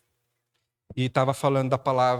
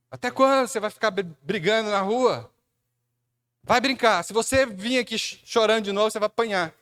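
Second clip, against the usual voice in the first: mock-scolding mother, higher and louder; 8 semitones higher, 4 dB louder than usual. Mother scolding her crying boy, mock angry. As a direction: wag a finger like an exasperated mom.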